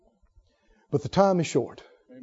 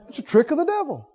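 normal talking voice

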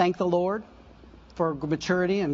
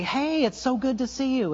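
bright greeting; 7 semitones higher than usual. Retold or imitated. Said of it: "I was able to just say".

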